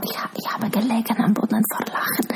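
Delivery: soft breathy whisper. Real, not acted. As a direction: whisper it through a grin. Erinnerung ans Lachen. (flüsternd)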